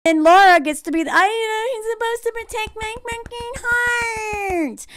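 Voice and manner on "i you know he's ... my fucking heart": mock heartbroken whine. Voicing Laura's heartbreak mockingly.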